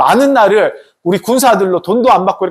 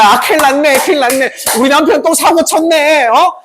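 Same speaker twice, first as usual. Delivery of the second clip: higher-pitched fretting wife; acted worry, pitched up and agitated; worried wife; 6 semitones higher than usual. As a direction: fret aloud over a husband in trouble. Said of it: "걱정이 돼".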